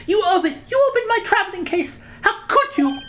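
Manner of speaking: shrill-high accusing lady, well above his own voice; high-pitched Pavlova. Acted. Pavlova accusing the intruder in a high-pitched voice. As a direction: shriek the accusation in a high put-on voice. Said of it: "the really high-pitched voice".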